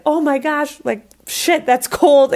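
mock a shocked reaction to icy water; startled, high-pitched imitation; gasping shock. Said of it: "they'll gasp".

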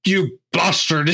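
put-on "bastard" said with a hard O, like the actor's odd accent; mock the actor's "bastard" with a hard O. Mocking the villain's odd accent to a co-host, hard-O "bastard". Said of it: "like, a hard O".

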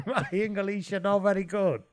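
put-on broken Italian-accented English. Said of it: "speaking in broken English".

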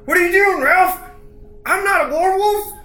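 very high, loud protest; acted frantic yelp of disbelief; panicked bandit. Bandit pleading with his comrade Ralph, panicked. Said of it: "bandit number one's like".

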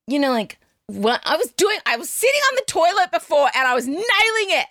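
usual speaking voice

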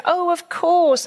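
high, slow "oh" of remembering; light up as it comes back to you; sudden realization. Pretend. Remembering where the keys are, a pleased surprise.

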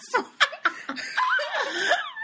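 high laughing cackle imitating the doll; hammed-up evil doll laugh; creepy laugh. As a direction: give a creepy doll's cackle, half laughing yourself. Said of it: "this creepy laugh".

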